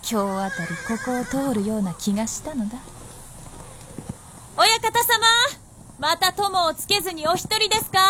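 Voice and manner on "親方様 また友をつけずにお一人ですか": bright greeting. Acted. Greeting the lord, performed.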